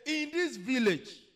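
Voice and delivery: high, very loud, drawn-out cry; acted curse. Condemned woman cursing the village.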